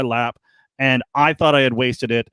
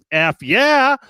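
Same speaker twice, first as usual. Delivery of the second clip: excited; 11 semitones higher, 3 dB louder than usual. Retold or imitated. Retold thrilled reaction.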